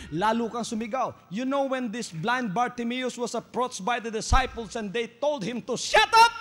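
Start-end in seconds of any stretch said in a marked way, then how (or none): none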